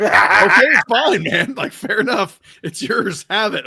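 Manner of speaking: loud, high mock surrender; exasperated giving in. Retelling his giving in to dad.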